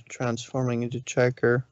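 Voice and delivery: ordinary delivery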